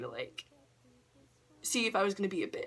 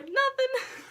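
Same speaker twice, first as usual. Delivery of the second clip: coy sing-song denial; 5 dB louder than usual. Retold or imitated.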